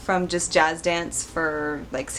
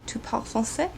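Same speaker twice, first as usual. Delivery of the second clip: French line with a Korean accent; softer French question with a Korean accent; 2 semitones higher, 6 dB quieter than usual. Shown as a demonstration. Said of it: "I said that with a Korean accent".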